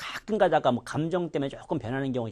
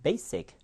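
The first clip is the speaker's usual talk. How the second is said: "basic" with BA- hit hard and higher, -sic falling away; stress on the first syllable; 5 semitones higher than usual. Shown as a demonstration. Teacher shows students what the stress mark means, front-stressed "basic". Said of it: "앞에를 세게 한다는 뜻이에요".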